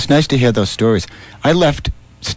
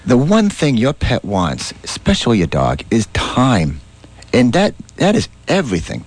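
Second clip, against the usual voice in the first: ordinary talk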